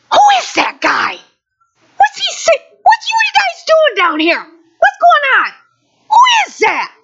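high, loud, incredulous yells, nearly crying. Retold or imitated. Preacher voicing David shouting at the cowering army. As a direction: shout it up high in disbelief, voice cracking. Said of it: "And [name]'s like".

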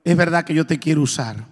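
a hint of a deep, solemn God; God's voice. God speaking to him at night, low and grave. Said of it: "me habló y me dijo".

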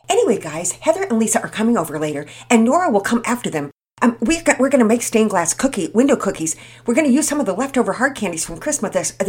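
put-on quick, loud girl chatter, words tumbling out; talking fast. Penelope inviting the boys to bake cookies, fast and loud. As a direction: rattle it off breathlessly. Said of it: "you talk so fast".

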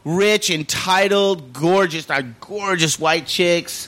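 drawn-out slow listing; slow savoring list. Real, not acted. Describing the rich women to friends, slow and relishing. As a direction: linger on each word.

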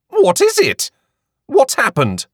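high, anxious questions; startled shopkeeper asks what's wrong; alarmed. A narrator's reading. Shopkeeper bursting from the back room, alarmed.

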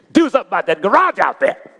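loud scornful order; sarcastic. Retold or imitated. Wife mocking her husband's offer with a loud jab. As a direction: bark it out as a mocking dare.

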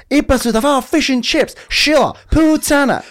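high, loud mock yell breaking into a laugh; shrieking impression of the neighbour yelling at his mum. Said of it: "φωνάζει της".

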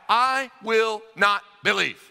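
"I will not believe" slow and loud, each word set apart; emphatic refusal. Acted. Thomas laying down his condition to the disciples, emphatic refusal. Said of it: "it's emphatic".

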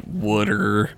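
"wooder" with the vowel of wood, low; mom's Philly pronunciation. Retold or imitated. Imitating mom's word for water, Philly "wooder". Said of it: "My mom says".

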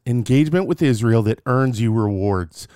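ordinary delivery

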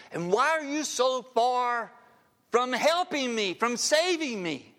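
loud, high, pleading cry to God; anguished cry. Acted. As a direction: cry it out like a forsaken man. Preacher crying out the psalm.

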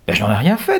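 high, mock-innocent girl voice. A narrator's reading. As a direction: deny it as innocently as possible. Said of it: "de sa voix la plus innocente".

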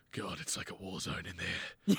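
low, hushed, world-weary murmur; a surgeon sighing to colleagues, low and hushed. Retold or imitated. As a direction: mutter it like a tired surgeon stepping out. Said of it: "and they go".

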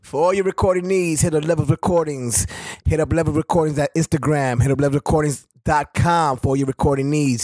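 steady promo voice, a bit low; ad read. Acted. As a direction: read it like a radio spot. Studio ad plug.

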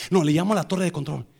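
ordinary talk

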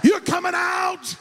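high, slow shouted preaching; rousing the congregation to a shouted climax; preaching at full force. Acted. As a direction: drive it home to the church.

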